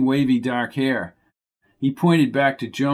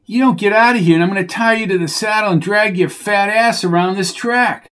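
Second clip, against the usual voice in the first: higher, louder menacing shout; furious threat; 7 semitones higher, 3 dB louder than usual. Acted. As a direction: threaten to drag him round the track. Coco threatens Bucky.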